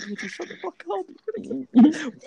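high panicked hush; mock panic. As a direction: squeak out a frantic warning. Panicked retold warning.

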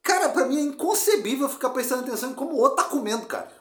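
indignant rant. Real, not acted. Ranting in disbelief.

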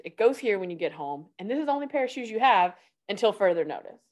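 ordinary talk, nothing marked

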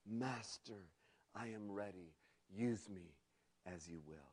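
soft, low and devoted. A narrator's reading. Bamboo answers his master, soft and low. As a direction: hush it, an offering of yourself. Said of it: "And his voice came low".

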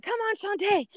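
high squeaky cheer from behind; breathless cheer. Retold or imitated. Runner falling behind cheering her friend. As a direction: cheer her on while gasping. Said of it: "I'm behind her and I'm like".

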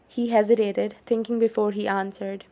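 usual speaking voice

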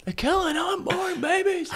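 high-pitched mock conspiracy rant; Alex Jones. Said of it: "Alex Jones one where he just goes".

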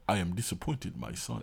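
low, hushed mock Nigerian God. Mimicking God to his son, soft low Nigerian accent. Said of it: "why did you do the Nigeria voice for God".

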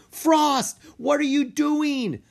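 high, loud cry of disbelief; yelling at dragon. A narrator's reading. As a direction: shout at her own dragon. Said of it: "Mina yelled at her dragon".

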